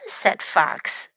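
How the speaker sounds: usual speaking voice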